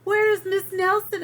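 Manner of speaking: put-on high, loud children's question; anxious kids. Kids asking the new teacher, high and loud. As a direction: ask it like worried children. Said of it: "asked the kids".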